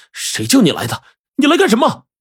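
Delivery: urgent questioning leaping to high pitch; alarmed demand. A narrator's reading. Young man confronting his friend's attacker, alarmed demand. Shocked witness interrogates the attacker.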